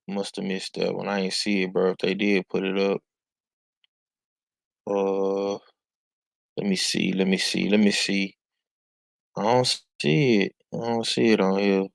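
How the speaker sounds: half-absent, checking as you talk; quiet, distracted self-talk; muttering while searching. Real, not acted. Mumbling to self while checking phone.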